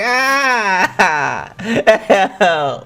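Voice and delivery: high, laughing mock plea; mocking cry for help. Viewer imitating a man who fell in, laughing mock plea. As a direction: imitate his wail through your laughter.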